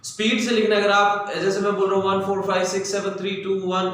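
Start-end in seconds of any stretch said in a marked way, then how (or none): 2.1-3.9 digits rattled off rapidly; quick-fire run of single digits; too-fast dictation. Shown as a demonstration. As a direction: race through the numbers without pausing. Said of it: "इतनी speed से तो नहीं बोलूंगा".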